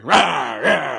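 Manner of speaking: lightly voiced Nixon growl; Nixon impression. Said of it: "my Nixon impression".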